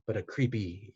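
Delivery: ordinary delivery, nothing marked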